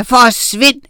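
loud, forceful dismissal; witch's commanding shout; shouted order. A narrator's reading. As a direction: order them away loudly. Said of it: "råbte Oda".

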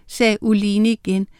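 ordinary delivery, nothing marked

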